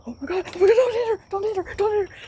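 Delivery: high frantic pleading, pitch shooting up; panicked pleading. Retold or imitated. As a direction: beg in panic, voice climbing. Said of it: "And I was so scared".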